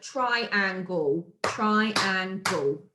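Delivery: "triangle" in three clear beats, tri-an-gle; syllable by syllable. Shown as a demonstration. Teacher counting syllables for pupils, "triangle" carefully broken in three. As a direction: sound out each chunk of "triangle". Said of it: "Three syllables".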